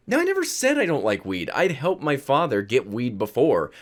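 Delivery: ordinary talk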